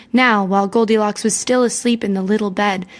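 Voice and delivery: ordinary delivery, nothing marked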